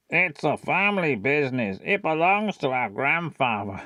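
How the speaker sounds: lightly put-on character voice; gentle singsong, barely put on; Tom talking like Adam. Shown as a demonstration. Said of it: "he talks like Adam".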